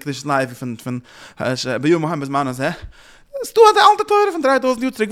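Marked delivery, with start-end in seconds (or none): 3.4-5.1 very high, loud sustained protest; high-pitched argumentative cry; exclaimed objection. Acted.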